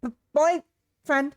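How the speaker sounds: put-on "boyfriend" blurted high, loud and slow after a stumble; "B-, boyfriend!" stammered then punched out; emphasis on boyfriend. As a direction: correct him by hitting "boyfriend" hard. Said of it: "putting emphasis on the word".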